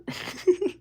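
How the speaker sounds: a sudden high squeal of a laugh; high squeaky laugh. Real, not acted. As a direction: let a high giggle slip out. Laughs at chat voting.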